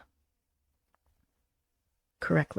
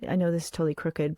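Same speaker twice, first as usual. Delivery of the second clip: ordinary talk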